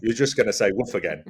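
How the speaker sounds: ordinary talk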